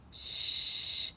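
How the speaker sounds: loud unvoiced shush; hushing shh. Storyteller hushing the children. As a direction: hush the room before the story.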